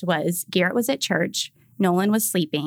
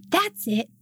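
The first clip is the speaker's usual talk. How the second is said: higher, indignant yelled outburst; toddler yell; 9 semitones higher than usual. Retold or imitated. Toddler yelling at the Target cashier, imitated indignant yell. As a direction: blurt it like a furious three-year-old. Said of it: "And he yells".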